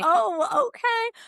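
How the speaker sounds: retold near-sob, breaking up high; weepy crack. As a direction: voice jumps high and cracks on the verge of tears. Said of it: "I was just so weepy".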